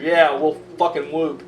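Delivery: ordinary talk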